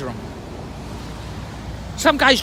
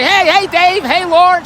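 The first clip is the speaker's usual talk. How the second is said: calling out higher and louder, across the street; hollering from the balcony; 7 semitones higher, 5 dB louder, slower than usual. Retold or imitated. Sister-in-law calling from the sixth-floor balcony, hollering. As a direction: holler down to the street to get their attention. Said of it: "She's hollering".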